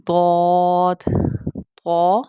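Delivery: ضاد and طا heavy and drawn out; full-mouthed, long ضاد, طا; thick, bold letters. Shown as a demonstration. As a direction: round the vowels of ضاد and طا to "aw". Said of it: "موٹا پڑھا جائے گا".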